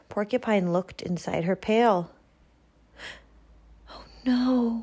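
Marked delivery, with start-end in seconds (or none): 3.9-4.8 gasp. Acted. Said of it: "she gasped".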